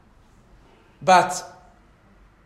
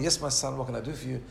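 softer, calm and patient; 4 semitones lower, 6 dB quieter than usual. Retold or imitated. Hillel calmly answers the pestering man, soft and patient. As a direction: answer gently, unruffled. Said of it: "comes out very calmly".

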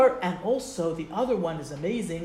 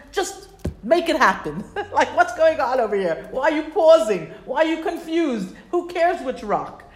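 higher and louder, half laughing; mock angry crowd; 5 semitones higher, 6 dB louder than usual. Crowd hounding Moshe and Aaron, mock exasperated. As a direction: badger your leaders, exasperated and playful. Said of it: "they start to scream at Moshe".

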